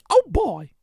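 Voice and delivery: high falsetto cartoon squeak; Mickey Mouse. Host riffing on Disney's mouse, squeaky voice. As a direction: chirp it in a helium voice. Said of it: "he had a helium voice when he said".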